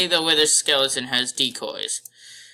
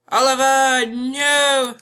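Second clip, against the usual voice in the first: higher, louder cry of dismay; alarmed cry; 9 semitones higher, 4 dB louder, slower than usual. Real, not acted. Player to teammate Oliver in danger, alarmed cry. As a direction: call out to a teammate in trouble, voice raised.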